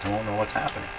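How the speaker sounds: usual speaking voice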